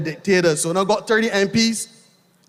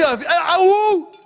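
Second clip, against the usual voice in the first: hype call; 8 semitones higher than usual. Real, not acted. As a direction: throw a high whooping call to the crowd. Rally cheer to crowd.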